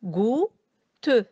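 "gou-te" in two separated syllables; split into syllables. Shown as a demonstration. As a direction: say "gou", pause, then "te". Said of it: "composé de deux syllabes".

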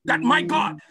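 fervent prayer. Acted. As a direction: pray fervently over the congregation. Leads intercessory prayer.

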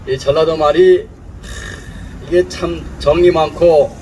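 ordinary talk

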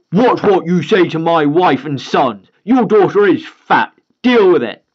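loud, slow menacing father; threatening dad. Acted. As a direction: lean in and warn him.

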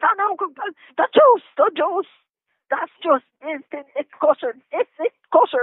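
high, spluttering mock rant; imitated yelling. Detainee mimics the officer arguing over kosher items, high mock yelling. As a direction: imitate a flustered officer squawking in protest. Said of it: "So he's yelling at me".

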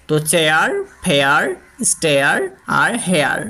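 চেয়ার, ফেয়ার, স্টেয়ার, হেয়ার each said clearly; model pronunciation. Shown as a demonstration. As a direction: drill the air words one by one. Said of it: "pronounce করতে হবে এগুলো". (প্রতিটি শব্দ আলাদা করে স্পষ্ট উচ্চারণ)

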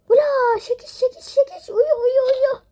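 high, loud panicked repeats; panicked alarm. Real, not acted. Woman realising she overslept, frantic high cries. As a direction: blurt it out in a frantic rush.

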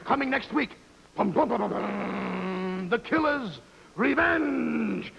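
high, ringing trailer proclamation; hammed-up preview announcer, stretching the big words; movie trailer announcer. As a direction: trumpet each line like an overblown coming attraction. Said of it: "how this coming attraction of a gangster picture looked to me".

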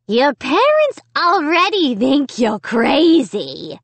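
slow, high dummy taunt; harsh, cold taunt. A narrator's reading. Dummy taunting Amy about her parents, harsh and cold. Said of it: "harsh, cold, whispery voice".

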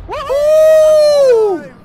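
very high, loud whoop; whooping cheer. Real, not acted. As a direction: let out a wild whoop. Celebrating a wild moment.

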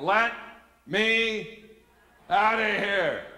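high, loud, each word on its own; desperate inner yell. Retold or imitated. Same thought, now desperate escalation.

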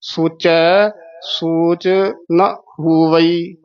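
slow ਸੋਚੈ, pause, ਸੋਚ, short ਨਾ, full ਹੋਵਈ; careful pauses. Shown as a demonstration. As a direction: space out ਸੋਚੈ ਸੋਚ slowly. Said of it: "ਇੱਥੇ ਯਮਕ ਲਾ ਦੇਣੀ". (ਸੋਚੈ ਸੋਚ ਨਾ ਹੋਵਈ ਹੌਲੀ)

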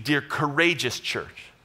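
ordinary talk

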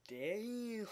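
damn pushed up high with a hammy fall; exaggerated damn. Vlogger hamming up his surprise, an exaggerated high damn. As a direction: overplay the damn for comic effect.

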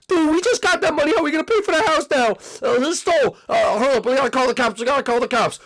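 high, frantic pleading; panicked. Acted. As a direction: blurt it out in a high-pitched panic. Panicking over stolen money.

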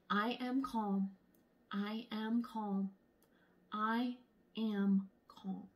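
slow, soft self-soothing; calm mantra. A narrator's reading. Monkey soothing herself, slow and soft. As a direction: breathe it out softly to yourself. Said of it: "Sunny said to herself".

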